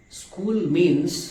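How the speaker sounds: ordinary delivery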